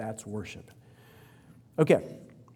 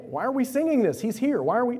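high, loud exasperated questions; 10 semitones higher, 5 dB louder than usual. Pretend. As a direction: throw up your hands in disbelief.

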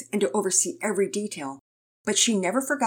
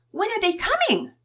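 put-on high-pitched, eager kid's question; high voice of a curious kid; eager child; 4 dB louder than usual. A narrator's reading. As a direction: ask it like an excited child, high up.

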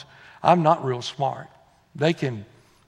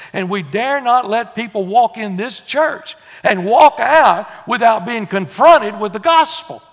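loud, raised pulpit exhortation; fervent preaching; 5 semitones higher, 7 dB louder than usual. Real, not acted. Preacher urges church to confront the lost, fervent preaching. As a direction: drive it home to the whole church.

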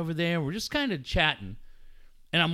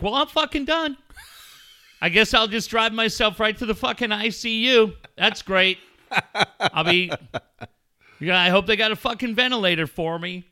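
higher, louder hammed-up paranoid rant; mock panic; 6 semitones higher, 5 dB louder than usual. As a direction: play the hypochondriac who's sure he's dying. Mock panic over an itchy throat.

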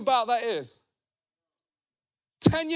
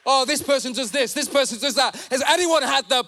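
fired-up sermon, hammering each phrase; impassioned preaching. Real, not acted. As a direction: rebuke the congregation in short, punched phrases. Preaches with force.

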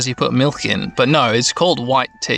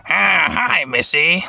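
breathier creature greeting, less high; imitated Japanese voice actor; 4 semitones higher, slower than usual. Podcaster shows listeners the Japanese Numemon voice, imitating. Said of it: "hear their Japanese voice actor going".

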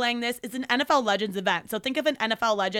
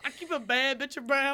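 pretend Southern accent; 2 semitones higher than usual. Storyteller redoing the rap for her friend, pretend Southern accent. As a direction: rap hook in a weird fake Southern twang. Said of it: "What is with the accent?".

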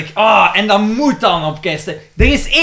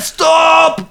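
mock shout; 8 semitones higher than usual. Retelling the film's arrest, shouting stop. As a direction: bark the order like a cop.

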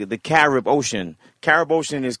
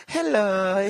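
a shopkeeper's sing-song hello; mock Chinese hello, higher; 6 semitones higher than usual. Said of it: "in Chinese, they got that".

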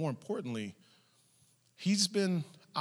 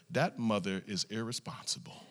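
low, quiet judgement; disapproving onlooker; 6 semitones lower, 8 dB quieter than usual. Retold or imitated. Onlooker judging a mother leaving two kids, quiet disapproval. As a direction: imitate a bystander tutting under their breath.

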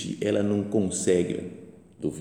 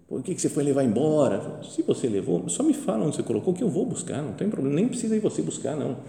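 higher, earnest plea, not angry at all; gentle pleading Mary; 6 semitones higher than usual. Acted. Mary begging the gardener for Jesus's body, higher and earnest.

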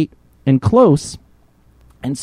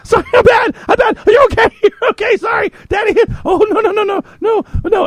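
much higher, louder panicked babble of sorries; frantic apology; 3 dB louder than usual. Retold or imitated. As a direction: gush apologies in a squeaky panic. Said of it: "And I go".

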